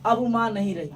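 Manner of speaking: ordinary delivery, nothing marked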